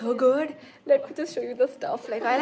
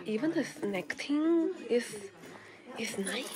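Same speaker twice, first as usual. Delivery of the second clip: ordinary talk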